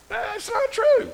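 very high, drawn-out protest; high-pitched comic rebuttal; incredulous objection. Real, not acted. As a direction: squeak it out slowly in disbelief. Rebuts the verse just read.

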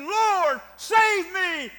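high, shouted plea to be saved; calling on the Lord. Acted. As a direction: cry out like a drowning man. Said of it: "I believe that's calling on the Lord".

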